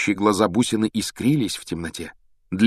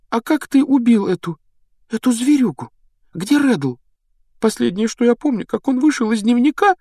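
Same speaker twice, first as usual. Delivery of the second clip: put-on high-pitched, pleading girl's voice; a girl confessing, voice lifted high and urgent; distraught young girl; 13 semitones higher than usual. Girl confessing to her rescuer what she was made to do, high and distraught.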